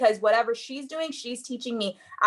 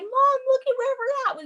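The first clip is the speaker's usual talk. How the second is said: much higher, thrilled exclamation to her mom; proud excited exclaim. Retold or imitated. A daughter showing her mom their vacation, thrilled and high. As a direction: shout it up high like showing off a dream. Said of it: "I could just be like".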